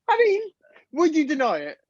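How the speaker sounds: high squeaky laughter through words; can barely speak for laughing; laughing hard. Real, not acted. Cracking up at own joke.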